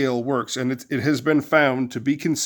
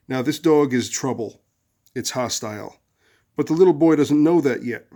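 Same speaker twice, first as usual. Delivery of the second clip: usual speaking voice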